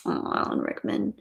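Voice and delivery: breathy hush on the name; admiring whisper. Pretend. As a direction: sigh the name like a fan.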